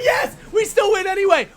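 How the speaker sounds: high, loud victory shout; triumphant yell. Real, not acted. Player celebrating a round win, triumphant yell. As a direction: shout it out in real excitement.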